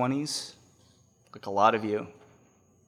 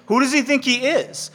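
scornful; 11 semitones higher, 5 dB louder than usual. Retold or imitated. David's scornful attitude voiced.

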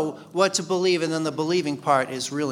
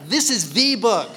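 "the" punched high as THEE before "book"; higher, louder, leaning hard on "the"; stress on THE; 5 semitones higher, 4 dB louder than usual. Shown as a demonstration. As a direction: declare it THE book, the only one. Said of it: "puts emphasis on that".

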